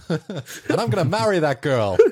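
laughing through a mock sad soldier; doomed soldier. Acting doomed soldier's backstory.

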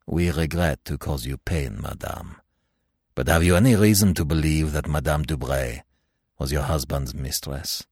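gently. Acted. Magistrate gently asking the widow a painful question. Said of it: "said gently".

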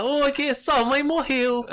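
high, slow, laughing cheerful tone; cheerful bad news. Shown as a demonstration. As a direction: deliver sad news far too brightly. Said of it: "quer dar uma notícia triste".

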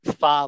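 pho said like fun with no final N; pho rhymes with fun, N dropped; pho pronunciation. Shown as a demonstration. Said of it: "like fun".

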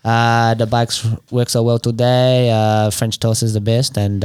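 slow, hesitant, low mock French; drawn-out French accent with long uhs. Plugging a mobile mechanic, slow French accent. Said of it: "in French".

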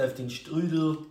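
put-on East German accent, vowel in "Strudel" pushed forward; ostdeutscher Akzent. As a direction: warn them like someone from East Germany. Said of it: "das sagt einer aus dem Osten". (mit ostdeutschem Zungenschlag)

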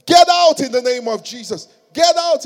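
loud, high demon-casting shout; exorcism command. Acted. Young ministers casting out a demon, forceful shouted command. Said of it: "there were these young ministers".

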